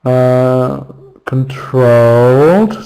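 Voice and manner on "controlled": "controlled" with a long, stretched second syllable rising at the end; second-syllable stress. Shown as a demonstration. Teacher marking stress for learners, "controlled" drawn out on second syllable. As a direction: stretch out the trolled in "controlled", slow. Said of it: "second syllable".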